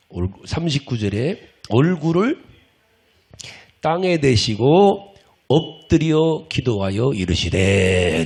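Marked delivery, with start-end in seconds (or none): 1.7-8.2 slow, measured reading of the verse; scripture reading. Acted. Preacher reading the verse to the congregation, slow and solemn. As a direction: read the Bible verse out slowly and solemnly.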